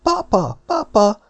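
put-on girl calling her papa. A narrator's reading. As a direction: call for her father across the room. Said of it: "kiabálta most Elza".

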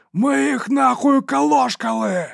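very high, slow, drawn-out bellow; loud victory yell. A narrator's reading. Dow waves his bloody weapon overhead, bellowing. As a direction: roar it out over the battlefield. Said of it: "Доу гучно заволав".

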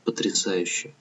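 very soft, falling away; barely audible. A narrator's reading. Sister answers her brother under the stars, barely audibly. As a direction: murmur it, almost too faint to hear. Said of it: "еле слышно ответил ему голос Лидии".

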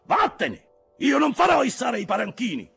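high, loud shouting; furious refusal. A narrator's reading. Ahab dismisses his officer and refuses the hoisting, furious shout. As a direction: bellow a flat refusal.

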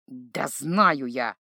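low, slow, wounded grumble; deeply offended. A narrator's reading. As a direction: sulk like a hurt child. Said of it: "Тише, но невероятно обиженным голосом". (обиженно и тише)

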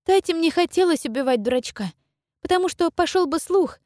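put-on high, earnest girlish voice; young girl voice. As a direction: speak as a determined young girl. Girl character held voice.

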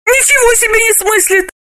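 high amazed female voice; astonished. Acted. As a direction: gasp in disbelief, high. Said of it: "поразилась Галина".

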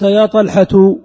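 "طلحة" said loudly in full as "ṭalḥatu"; damma on ت. Shown as a demonstration. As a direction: sound the "tu" closing "طلحة", loud and clear. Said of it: "التاء مضمومة". (نطق "طلحة" بصوت عالٍ مع ضم التاء)

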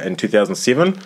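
ordinary talk

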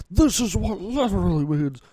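high-pitched mock indignation; mock mad commenter. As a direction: imitate an irate comment writer. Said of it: "sounds like he's so mad".

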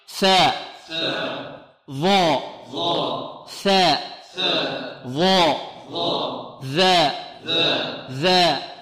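ثاء and ظاء said with the tongue tip out; letter pronunciation. Shown as a demonstration. A teacher asking the class to say the letters with him, careful articulation. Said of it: "انطقوا معي".

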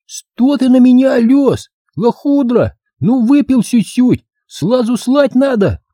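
put-on high, slow childish lisp; squeaky kid aping a husband's row; little boy playing drunk. Boy playing husband answers nagging wife, high childish lisp.

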